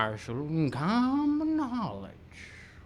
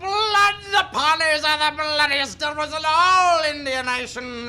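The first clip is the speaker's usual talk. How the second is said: loud raving outburst; 6 semitones higher, 5 dB louder than usual. Acted. Old man rants about Pawnees.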